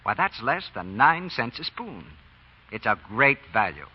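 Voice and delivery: normal talking voice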